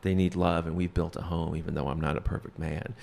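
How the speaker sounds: soft, low, humble plea; kinda eloquent. Retold or imitated. Earnest father testifying at his custody hearing, quietly eloquent. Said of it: "he gets really kinda eloquent".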